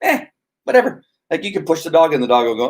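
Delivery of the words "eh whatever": high, indifferent 'eh'; lightly voiced nonchalance; unbothered dog. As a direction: shrug it off like a mellow dog. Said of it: "a mild dog usually is just kinda like".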